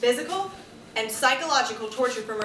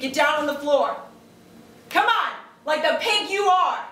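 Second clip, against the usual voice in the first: taunting husband; 5 semitones higher, 5 dB louder than usual. Acted. Joel humiliates her.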